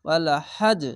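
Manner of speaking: হাজ ends in a bounced, echoing জ; qalqala bounce. Shown as a demonstration. Teacher showing how হাজ is read, with a bouncing stop. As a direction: snap the জ of হাজ with a little rebound. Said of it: "এভাবে পড়বেন".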